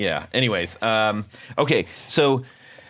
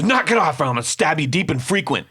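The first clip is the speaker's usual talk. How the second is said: angry threat; 7 semitones higher than usual. Retold or imitated. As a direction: snap it out like a furious Detroit tough guy. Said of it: "he said it so fast and in, a blistering slur".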